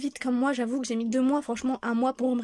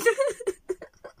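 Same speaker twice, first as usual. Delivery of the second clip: high laughing through tears; tearful laugh; 10 semitones higher than usual. Real, not acted. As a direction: laugh while half crying. Tearful laugh after joke.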